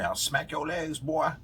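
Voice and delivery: high-pitched imitation of a scolding old woman; Betty. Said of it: "old Betty used to say".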